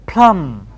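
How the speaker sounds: "plum", no b sound at the end; "plum" spoken with the b silent. Shown as a demonstration. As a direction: say "plum", drop the final b. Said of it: "And P L U M B".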